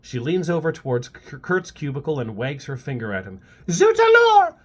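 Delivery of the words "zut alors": high, whiny mock French accent; French accent whine. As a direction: scold in a shrill French whine. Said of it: "scolds in a high-pitched French accented, French accented whine".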